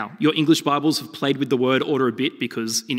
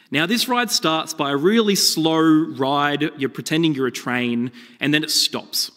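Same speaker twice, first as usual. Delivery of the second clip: ordinary talk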